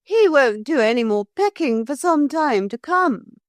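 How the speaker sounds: high, pleased villainess; Pretenderette's voice. A narrator's reading. Pretenderette gloats about the bird, smugly high.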